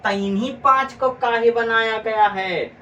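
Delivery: high, shouted question to the class; loud rhetorical question. Real, not acted. Teacher asks why these five were chosen, loud and high. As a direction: throw the question out at full volume, high.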